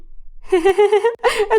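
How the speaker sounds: high-pitched giggle of ridicule; mocking laugh. A narrator's reading. Woman hearing her rival's surname, mocking laugh.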